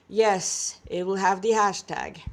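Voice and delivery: ordinary delivery, nothing marked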